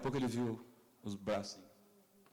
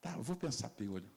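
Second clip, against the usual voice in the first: softer, lower sly reply; deadpan teasing wife; 7 semitones lower, 5 dB quieter than usual. Retold or imitated. Wife slyly refusing her husband, softer and lower. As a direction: say it flat with a sly sting.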